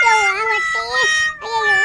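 mock shout about the belly; hammed-up stomach-ache shout; fake pain yell. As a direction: cry out in pretend agony. Staged fake pain cry.

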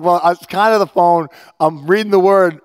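loud, high pleading excuse; dad excusing himself to daughter; defensive protest. Retold or imitated. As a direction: justify yourself to the boss. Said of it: "But I'm like".

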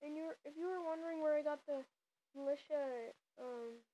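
ordinary talk

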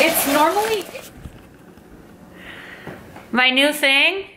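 talking through a mouthful; words muffled by something in the mouth; mouth full. Real, not acted. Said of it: "I can't talk with this in my mouth".

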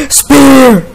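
loud, high yell; frustrated shout. Real, not acted. Player losing a battle with game monsters, frustrated shout. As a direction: belt it out in exasperation.

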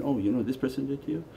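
soft, quick throw-in; subtle whispered aside. Retold or imitated. Shaitan planting suspicion in a meditator, subtle aside. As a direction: slip it in softly and fast. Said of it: "and then subtle throw in".

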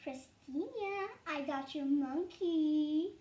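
slow, drawn-out taunt; slow teasing lure; teasing call. Acted. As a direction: dangle the bait, stretching the words. Luring sibling with her toy.

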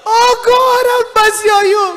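high, loud, sobbing plea; crying out. Retold or imitated. Preacher weeping over the pastor, crying out for mercy. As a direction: weep and beg for mercy. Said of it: "I started crying with him and said".

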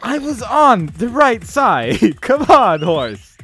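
frustrated shout. Real, not acted. Player yelling at the stubborn horse, in a loud frustrated outburst. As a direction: yell at the horse in real outrage. Horse fails the obstacle jump.

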